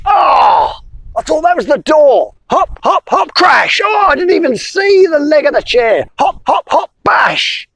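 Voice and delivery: blunder about like a dazed frog; clumsy frog. Acted. Frog crashing in, high and flustered.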